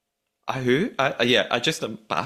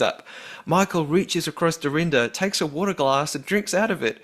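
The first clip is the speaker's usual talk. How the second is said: higher-pitched put-on Australian accent; 5 semitones higher than usual. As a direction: read the email out as a lilting Australian. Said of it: "read it in a different accent".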